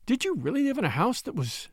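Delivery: high, hushed, frightened question; fearful whisper. Acted. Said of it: "she whispered fearfully".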